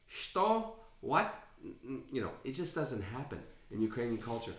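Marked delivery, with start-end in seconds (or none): 0.1-1.3 high-pitched, incredulous question; mock disbelief. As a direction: imitate a man taken aback. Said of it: "he'd be like".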